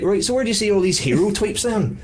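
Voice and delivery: put-on high-pitched Liverpool accent, a bit Belfast. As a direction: voice the guard in a high wee Scouse accent. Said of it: "this is what this guy sounds like now".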